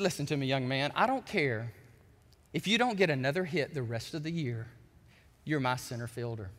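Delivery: low, measured reassurance from a manager; softened, heartfelt retelling with weighted pauses; quietly moved. Retold or imitated. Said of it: "I almost get emotional when I say this".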